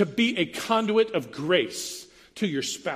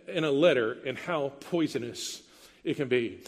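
ordinary talk, nothing marked

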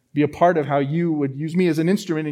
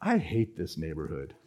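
muttered thought; 11 semitones lower, 10 dB quieter than usual. Retold or imitated.

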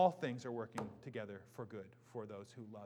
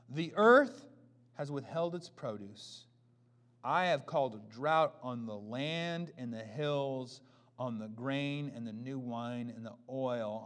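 scripture reading; 10 dB louder than usual. Acted. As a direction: pronounce the judgment solemnly.

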